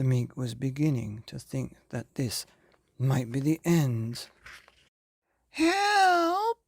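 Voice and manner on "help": high, loud panicked cry; man in the lake screaming help. Acted. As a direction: yell for help as the jaws close in. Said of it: "he cried".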